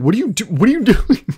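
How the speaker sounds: high, incredulous outburst breaking into laughter; alarmed, pitched well up at the dog; alarmed disbelief. Retold or imitated. Man to a dog screaming at him, alarmed disbelief.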